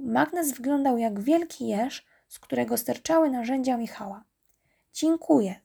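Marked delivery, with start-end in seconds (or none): 4.9-5.6 higher, louder grateful call; raised-voice thank-you. A narrator's reading. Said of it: "zawołał Michał".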